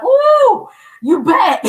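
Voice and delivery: high, loud delighted ooh; joyful surprise. Retold or imitated. Feeling God's presence come back, delighted high greeting. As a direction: greet him like a long-lost friend.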